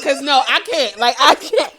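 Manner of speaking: loud, laughing "I can't". Real, not acted. Host reacts to her cohost's Costco story, laughing loudly. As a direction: say it while cracking up.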